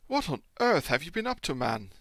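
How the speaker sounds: high, loud startled question; doctor exclaims at the haggard traveller; alarmed question. Acted. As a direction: demand it in shock.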